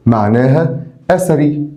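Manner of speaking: ordinary talk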